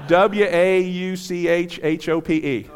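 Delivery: slow deliberate spelling, letters spaced out and laughing. Shown as a demonstration. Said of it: "spell War Hope".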